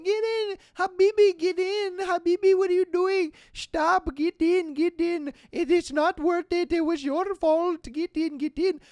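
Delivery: high, pleading shouts in a put-on Middle Eastern accent; mock family yelling. Wife and kids calling from the van, mock yelling. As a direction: imitate a frantic wife calling him back. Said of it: "his wife and kids are yelling".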